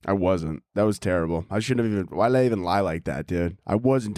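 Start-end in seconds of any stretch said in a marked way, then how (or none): none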